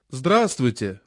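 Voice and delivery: usual speaking voice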